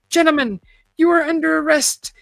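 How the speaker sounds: a triumphant officer's call; loud announcement. A narrator's reading. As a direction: announce it to the whole room. Agent announces arrest to men.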